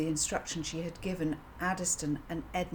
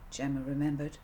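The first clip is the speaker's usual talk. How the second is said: plain reading voice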